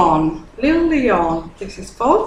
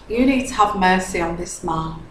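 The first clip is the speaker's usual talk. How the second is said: ordinary talk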